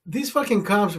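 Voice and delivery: normal talking voice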